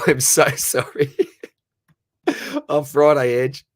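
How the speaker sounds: slow, laughing through the words; laughing apology. Real, not acted. Streamer laughs while apologising to Ange for forgetting. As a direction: giggle out a sorry.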